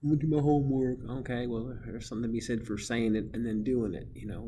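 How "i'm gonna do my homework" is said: higher, building louder student voice; put-on kid voice growing louder; students' promises. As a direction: recite promises as a mock pupil. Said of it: "And a lot of you said".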